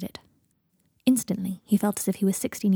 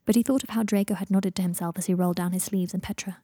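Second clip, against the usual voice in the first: ordinary delivery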